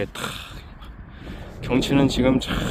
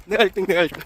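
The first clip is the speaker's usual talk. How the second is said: excited; 8 semitones higher than usual. Real, not acted. Wins the race.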